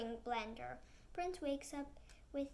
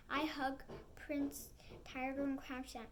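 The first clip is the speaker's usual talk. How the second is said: ordinary delivery, nothing marked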